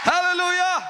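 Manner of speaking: high loud hallelujah; shouted praise. Real, not acted. Preacher rousing the congregation, loud high hallelujah. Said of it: "preacher shouts praise".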